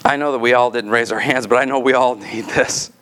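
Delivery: steady, earnest prayer over the congregation; closing prayer. Acted. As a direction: pastor praying for the church, earnest prayer voice.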